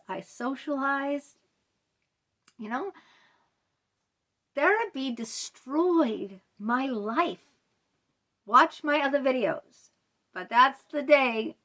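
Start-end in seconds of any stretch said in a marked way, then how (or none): 4.6-7.6 very slow, heavy, each word weighed; grave emphasis. Real, not acted. Client closing her complaint about therapy, slow and bitter. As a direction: lay it down slowly, bitter and final.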